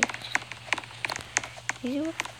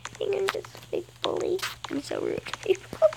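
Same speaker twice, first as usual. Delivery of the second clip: higher, soft mock whine; half-joking higher confession; 6 semitones higher, 9 dB quieter than usual. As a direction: play at being a bully. Mock self-insult to viewer.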